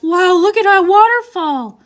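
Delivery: loud, high, slow amazed gasp; excited gasp. Shown as a demonstration. Teacher acting out a boy amazed by a waterfall, loud and high. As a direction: gasp it out in wonder. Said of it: "You notice how my voice changes when things have quotations".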